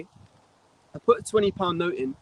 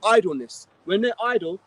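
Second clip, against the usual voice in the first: ordinary delivery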